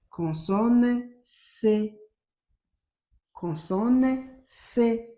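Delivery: slow "consonne C", stretched with a pause before C; letter introduction. Shown as a demonstration. Teacher presents a new letter to pupils, slow "consonne C". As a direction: announce "consonne C" very deliberately, C set apart.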